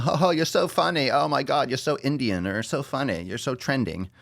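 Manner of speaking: high, gushing fan voice; mocking gushing fan. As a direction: gush like a fawning fan. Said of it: "That's what everyone says".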